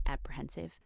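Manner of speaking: plain reading voice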